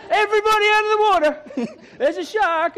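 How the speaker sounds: high-pitched beach alarm, played for laughs; mock panic shout. Acting a shark alarm at sea.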